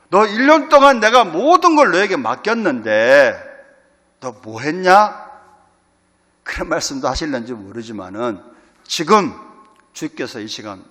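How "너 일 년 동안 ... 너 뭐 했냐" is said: stern scolding. Pretend. Imagined Lord scolding the congregation.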